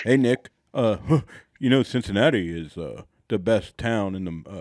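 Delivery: a lightly voiced low dad, chuckling; low dad impression. Friend imitating Nikki's dad at her request, low and easy. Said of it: "Try to do an impression of my dad".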